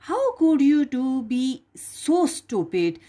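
mother scolding Jack over the beans; angry mother. Acted. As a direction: scold the foolish boy. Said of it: "cried Jack's mother".

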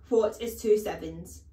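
loud headmistress demand; barked question. Acted. Headmistress testing small boy on tables, loud and demanding. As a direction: demand the answer sharply.

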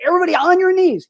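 fast, high mock preacher command; gospel evangelist. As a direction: imitate a revival preacher ordering the room down. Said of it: "does this gospel evangelist".

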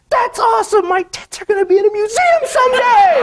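very high, loud squealing delight; Stephanie's reply. Retold or imitated. Woman answering the artist, very high loud squeal. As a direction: squeal it like a thrilled girl. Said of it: "and she goes".

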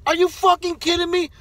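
ordinary talk

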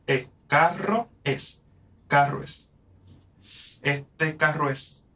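carro es linked into one syllable, drawn out; fuse the o and es; vowels joined. Shown as a demonstration. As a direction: sinalefa demo. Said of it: "Menos uno".

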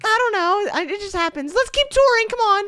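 put-on high falsetto girly voice; man doing a woman's voice. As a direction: squeak it up high like a girl. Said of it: "Get your girl voices ready".

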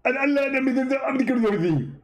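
high-pitched mock debater voice; a squeaky, smug rival preacher; Zakir Naik impression. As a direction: push the voice way up and lampoon him. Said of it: "how you talk like that".

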